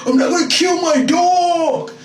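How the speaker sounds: high, loud, slow hammed-up wail with laughter; mocking the boyfriend's tearful protest, loud and laughing. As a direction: wail it like a crybaby. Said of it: "let's see what happened".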